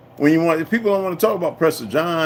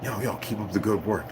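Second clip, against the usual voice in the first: softer quoting a caller on the phone; hushed friend; 5 semitones lower, 9 dB quieter than usual. Retold or imitated. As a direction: pass on the praise lightly and quietly. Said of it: "and they be like".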